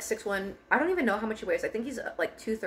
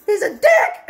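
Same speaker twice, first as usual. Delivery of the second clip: crying and yelling; 11 semitones higher, 10 dB louder than usual. Retold or imitated. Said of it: "And I was crying".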